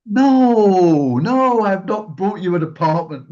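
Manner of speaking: loud, high, laughing no; laughing denial. Retold or imitated. Telling the friend it's not an apartment, laughing loudly. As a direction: protest through laughter.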